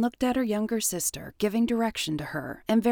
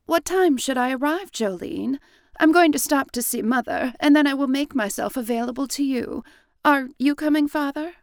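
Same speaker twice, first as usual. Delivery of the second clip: higher, eager younger sister; a lightly raised, earnest sister voice; Jennifer asking; 6 semitones higher, 7 dB louder than usual. A narrator's reading. Jennifer asking her sister and father, higher eager voice. Jennifer asks about plans.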